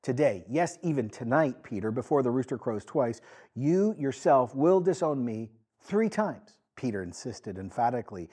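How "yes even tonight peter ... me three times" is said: ordinary delivery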